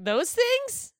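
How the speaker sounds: very high, loud appalled question; mock disbelief. Friend voices a kid learning about breastfeeding, mock appalled. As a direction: squeal it as a grossed-out kid.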